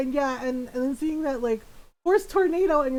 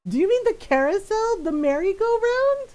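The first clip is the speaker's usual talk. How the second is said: incredulous checking; 8 semitones higher than usual. Retold or imitated. Retold clarifying question to mother.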